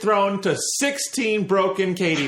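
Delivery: emphatic proclamation. Real, not acted. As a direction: hammer the point home, slowly and high. Emphatically proving a point after letter.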